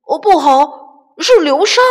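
loud high alarmed cry; shouting. Acted. Chubby chick shouting a warning about the quicksand. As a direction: warn of quicksand. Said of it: "小胖墩喊道".

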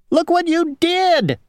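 high-pitched, squealing outrage; indignant worm. A narrator's reading. Worm to the one who bit its home, high indignant cry. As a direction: scold in a very high little voice. Worm scolds the apple eater.

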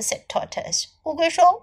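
ordinary delivery

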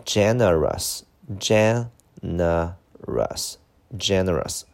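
slow teacher's model of "generous", broken into syllables. Shown as a demonstration. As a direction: say "generous" slowly, part by part. (逐个音节慢读generous)

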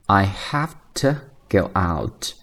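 "have to" as haf-tuh; natural-speech demo with unstressed "to"; weak to. Shown as a demonstration. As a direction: reduce "to" to a short tuh in "I have to go out". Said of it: "更加自然的一个说法就是".

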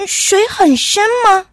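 high, loud, astonished asking; startled question. A narrator's reading. Little horse hears the squirrel's warning, asks in surprise. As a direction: ask it taken aback, pitched right up. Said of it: "小马吃惊地问".

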